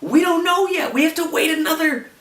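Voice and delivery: high-pitched, worked-up fan outrage; beside yourself over a cliffhanger; exasperated outburst. Real, not acted. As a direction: rant at a high pitch, nearly yelling. Outraged rant about the cliffhanger ending.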